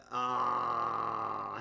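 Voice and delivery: normal talking voice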